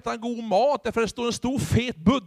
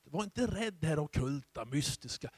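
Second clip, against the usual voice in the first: normal talking voice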